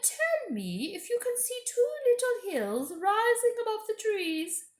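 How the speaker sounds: high, sweet coaxing queen; high, coaxing witch-queen voice; queen's voice. Acted. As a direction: lure the boy in with a high honeyed voice. Queen speaks to Edmund.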